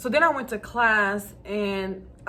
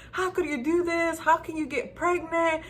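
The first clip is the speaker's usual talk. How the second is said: high, shrill-free mock scolding, pitched up; imitating mom yelling; 7 semitones higher than usual. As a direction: play the upset mom scolding. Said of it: "she was yelling at me".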